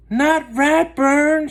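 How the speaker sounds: high, loud, very slow anguished cry; a dragged-out, despairing yell; shout. Acted. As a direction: wail it in horror. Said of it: "shouted Arthur".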